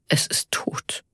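soft, whispery statement; hushed finding. A narrator's reading. Karl finds the sheep without pulse, hushed. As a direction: let it out under the breath.

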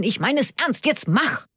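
high, insistent urging; impatient command. A narrator's reading. Karl presses Onno to drive, impatient.

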